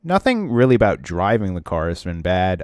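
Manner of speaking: usual speaking voice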